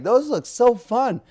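high, loud, drawn-out admiration; acted-out childlike fascination; mock delight. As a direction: gush slowly over tempting treasure. Acting Joseph eyeing other treasures.

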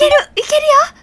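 high, loud excited cheer. Real, not acted. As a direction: pump yourself up. Excited self-encouragement mid-quiz. (興奮して叫ぶ)

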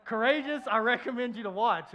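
loud, pointed recommendation; loud pointed plea; emphatic recommendation. Real, not acted. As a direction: press it on them, loud and high. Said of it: "pointed recommendation".

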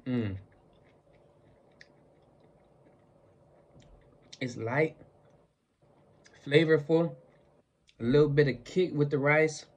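talking between chews; words muffled by food; mouth full, chewing. Real, not acted. Seen 0: Eats a bite at -9 to -7, then pursed, chewing-like mouth.

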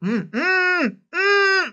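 high coughing from coffee down the wrong pipe; coffee stuck in his throat, coughing; choking cough. Real, not acted.

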